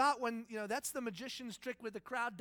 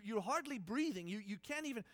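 ordinary talk

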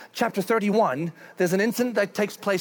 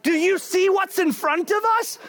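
high, loud pleading donkey voice; donkey pointing out the obvious; donkey warning Balaam, exasperated; 12 semitones higher, 5 dB louder than usual. Retold or imitated. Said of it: "and the donkey's like".

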